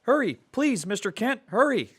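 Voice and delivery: put-on high, urgent boyish voice; an anxious young sidekick begging for help; boy Robin, upset. Boy phoning the reporter for urgent help, high and worried.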